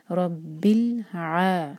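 रब्बिल आ very slow, heavy रॉ, ल sounded; recitation demo. Shown as a demonstration. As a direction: stretch रब्बिल आ in Quranic recitation. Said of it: "तो लाम pronounce होगा". (रब्बिल आ धीमी तिलावत में)

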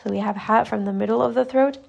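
normal talking voice

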